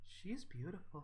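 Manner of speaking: hushed, awed soft voice. Acted. Other daughter behind you admiring the axe, soft. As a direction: breathe it out softly in admiration. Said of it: "you just hear this soft".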